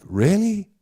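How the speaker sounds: high, rising disbelief; incredulous. Retold or imitated. Reacting to the Last Outpost stickers, incredulous. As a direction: pitch it right up, questioning.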